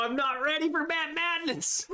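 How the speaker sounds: squeaky high panicked exclaim; squealing high. Real, not acted. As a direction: voice jumps high in playful panic. Nervous about the timed tasting.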